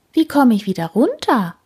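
anxious, a bit shaky question up the tree; mild fear. A narrator's reading. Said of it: "Sie hat Angst".

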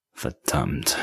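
muttered curse. A narrator's reading. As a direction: mutter it to herself, defeated. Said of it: "sagte sie wieder".

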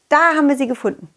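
usual speaking voice

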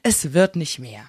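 ordinary talk

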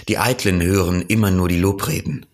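usual speaking voice

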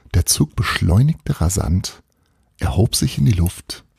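usual speaking voice

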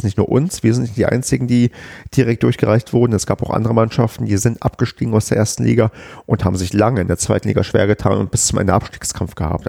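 ordinary talk

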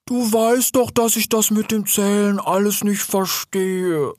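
high, slowing, plaintive bear voice; sad. A narrator's reading. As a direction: whine it sadly, dragging the end. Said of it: "sagte der Bär traurig". (traurig, hoch und langgezogen)

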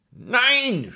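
hohes trotziges Kindernein; trotziges Kind. Acted. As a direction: schrei das Nein hoch und trotzig. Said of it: "schrie Häwelmann". (Häwelmann schreit dem Mond hoch und trotzig entgegen)

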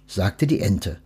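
ordinary delivery

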